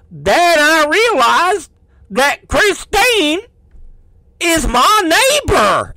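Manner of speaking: slow, high, hammed-up cop drawl; cop's drawling voice. Detective revealing he knows Christine, slow put-on drawl. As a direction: draw out the big reveal in a drawling accent. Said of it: "that's how he sounds".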